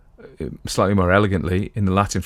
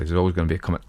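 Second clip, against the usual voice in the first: usual speaking voice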